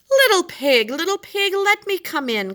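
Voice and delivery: high, loud, strained calling; upset shark calling. Acted. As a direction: shark losing patience at the door. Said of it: "called the magic shark, growing upset".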